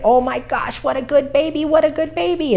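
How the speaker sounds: put-on high, gushing baby talk; high sing-song puppy praise. Shown as a demonstration.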